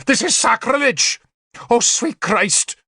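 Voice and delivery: high, horrified wail; screamed outrage. A narrator's reading. Bishop appalled by witch in church, high screaming. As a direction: cry sacrilege at a high pitch. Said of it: "he screamed".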